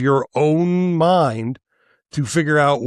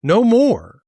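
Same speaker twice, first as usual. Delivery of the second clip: higher, forceful "No more."; angry declaration, higher; emphatic; 8 semitones higher, 4 dB louder than usual. Real, not acted. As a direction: slam the door on them. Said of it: "I'm worked up".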